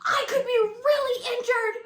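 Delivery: high tearful laughing quote. Retold or imitated. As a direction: quote her while crying laughing. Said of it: "You were like".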